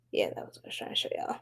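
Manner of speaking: fast breathy whisper aside; rushed whisper to the viewers. Real, not acted. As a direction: hurry it out under your breath. Aside while showing cards.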